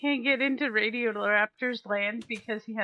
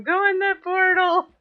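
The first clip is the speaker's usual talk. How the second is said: shouted from the next room; from another room; 8 semitones higher, 9 dB louder than usual. Retold or imitated. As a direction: yell it as if through a wall. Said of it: "it's just me from the other room".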